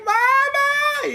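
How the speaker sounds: high mock wail for mama; crying kid. Streamer imitates the crying kid calling his mom. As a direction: cry out like a scared child. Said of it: "start crying".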